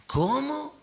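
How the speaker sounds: high, loud, startled "¿Cómo?"; shocked outburst. Retold or imitated. Marido oye que está muerto. (sorpresa indignada, aguda y fuerte)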